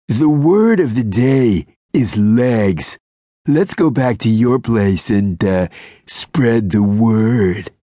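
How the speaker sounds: slow, smarmy leer; sleazy pickup voice. A narrator's reading. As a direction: drag out the cheesy line.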